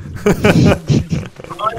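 bright burst of laughter. Real, not acted. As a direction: laugh out loud in amusement. 笑别人一直闪. (开怀大笑)

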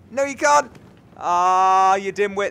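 high, very loud frustrated outburst; exasperated yell. Real, not acted. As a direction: yell at a useless game character. Yelling at game character failing.

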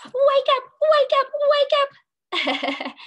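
very high, loud, fast wake-up cry; game whistle sounding to wake the class; shrill whistle-like wake up. Pretend. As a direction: blast it like a whistle. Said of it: "I'm gonna blow my whistle".

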